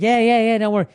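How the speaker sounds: salesman's breezy brush-off; dismissive salesman. Retold or imitated. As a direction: wave off the customer's worry. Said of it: "And the guy's like".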